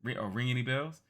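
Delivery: put-on loud, pushy young thief; tough girl Kid. Kid interrogating the old man, pushy and loud. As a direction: shove the question in his face. Kid's voice.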